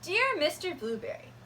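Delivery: ordinary delivery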